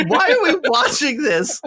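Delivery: high, drawn-out tearful whine; slow, high-pitched mock sob with a laugh in it; mock crying. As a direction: imitate a crying wife's protest. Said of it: "she's crying".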